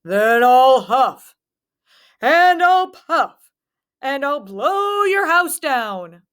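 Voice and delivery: gloating wolf drawing out his threat; wolf taunts the pigs in the brick house, slow. A narrator's reading. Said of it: "laughed the wolf".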